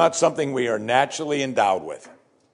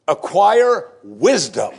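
Acquire wisdom slow, higher and louder, each word leaned on; emphatic command; 5 semitones higher, 5 dB louder, much slower than usual. Shown as a demonstration. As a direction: press hard on Acquire wisdom, slow and lifted. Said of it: "with the emphasis it needs".

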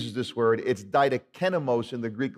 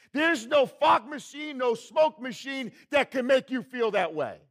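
full-force preaching; 10 semitones higher than usual. Acted. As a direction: build the crowd up with a raised voice.

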